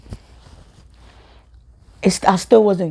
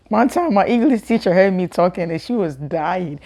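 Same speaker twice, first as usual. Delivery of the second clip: ordinary talk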